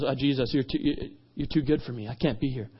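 soft, low, halting admission; hushed and hesitant, voice dropped; humbled. Retold or imitated. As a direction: shrink back, feeling unworthy. Peter humbly turns Jesus away.

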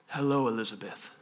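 very low, soft hello; unexcited greeting. Acted. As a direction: greet without any fanfare. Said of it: "that's not like a blah moment".

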